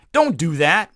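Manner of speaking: ordinary delivery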